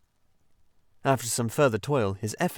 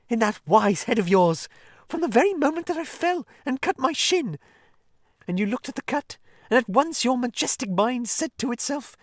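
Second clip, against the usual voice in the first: far higher, louder excited Mole voice; Mole, gushing admiration; 14 semitones higher, 5 dB louder than usual. Acted. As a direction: heap praise on him breathlessly.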